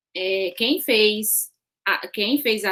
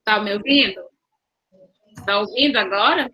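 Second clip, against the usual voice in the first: louder, checking she can hear; to someone hard of hearing; 6 dB louder, faster than usual. Real, not acted. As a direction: call into a bad connection.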